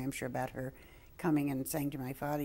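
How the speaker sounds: usual speaking voice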